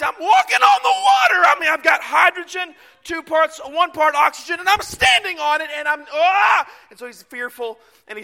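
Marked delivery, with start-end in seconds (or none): 0.0-1.5 high-pitched incredulous shout; panicked amazement. Retold or imitated. Preacher voicing Peter on the waves, panicked high shout. 1.5-6.7 loud, raised-pitch comic alarm; mock panic. Preacher voicing Peter atop the water, loud mock panic.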